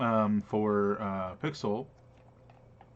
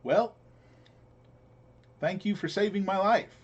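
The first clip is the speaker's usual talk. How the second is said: higher-pitched, grateful little merchant; pitched-up parting thanks, in character; departing merchant's voice; 9 semitones higher, 3 dB louder than usual. Acted. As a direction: thank them in a raised character voice. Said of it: "he's like".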